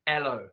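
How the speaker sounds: hello said as ello, no H; dropped H. Shown as a demonstration. Teacher repeating the Cockney hello without the H. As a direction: drop the H in hello. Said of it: "She's dropped the H".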